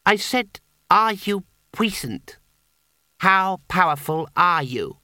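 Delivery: put-on high, slow, deliberate child's voice; insistent boy. Boy repeats his question to the wizard, slow and insistent.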